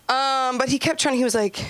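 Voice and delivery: ordinary delivery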